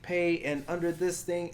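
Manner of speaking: the pushy caller demanding details; scam caller voice. Retold or imitated. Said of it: "they're like".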